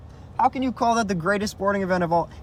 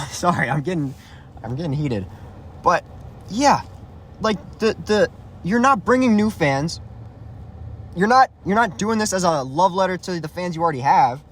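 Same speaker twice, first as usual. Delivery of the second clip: ordinary delivery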